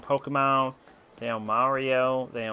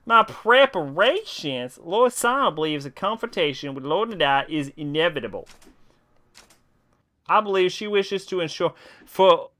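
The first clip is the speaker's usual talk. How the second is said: raised voice reading game lines; character voice; 7 semitones higher than usual. Acted. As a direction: act out the game dialogue. Reads game dialogue aloud in voices.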